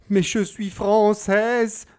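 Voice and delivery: hoch, laut und langsam beteuernd; in großer Angst. A narrator's reading. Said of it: "versichert ... in großer Angst". (ängstlich beteuernd, hoch und laut)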